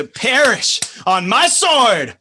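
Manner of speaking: slow loud threat; fierce vow. Acted. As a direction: swear vengeance aloud. Lysander threatening Demetrius.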